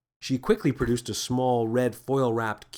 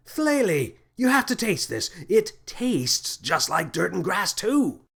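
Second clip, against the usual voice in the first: much higher, gleeful call; delighted shout; 7 semitones higher than usual. A narrator's reading. Captain urges Flaley to taste the ball, high gleeful shout. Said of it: "and shouted".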